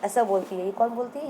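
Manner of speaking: ordinary delivery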